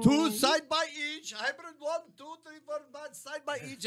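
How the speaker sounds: put-on Indian accent. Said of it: "was that an Indian accent".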